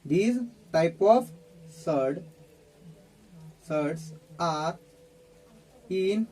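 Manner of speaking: very slow, halting dictation. Real, not acted. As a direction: spell out the sentence chunk by chunk. Dictates while writing on board. (बहुत धीरे, रुक-रुक कर लिखवाते हुए)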